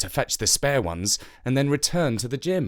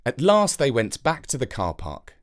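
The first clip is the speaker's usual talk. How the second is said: ordinary delivery, nothing marked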